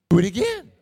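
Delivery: put-on loud, eager child's plea; eager grandson. Grandfather imitating grandson, loud eager plea. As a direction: beg like a delighted kid. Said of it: "what do you think he says?".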